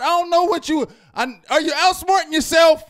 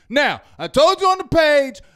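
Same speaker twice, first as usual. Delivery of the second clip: ordinary delivery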